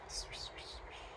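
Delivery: soft unvoiced "shh" swishes; swishing leaves. A narrator's reading. Storyteller imitating leaves falling on the roof, hushed swishing. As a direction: imitate rustling leaves in short hushed bursts. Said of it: "The leaves still fell on the roof".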